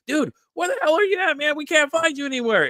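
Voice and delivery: very high-pitched mock exasperation; imitated frantic friend. Friend phoning the missing drinker, imitated exasperation. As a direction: pitch it way up, like a worried buddy on the phone.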